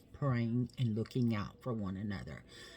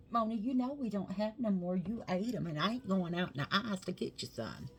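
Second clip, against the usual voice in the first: higher, lightly put-on voice chiding the dog; talking to the dog; 6 semitones higher than usual. Real, not acted. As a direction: playfully tell the dog off, voice raised in pitch. Scolding the dog about snacks.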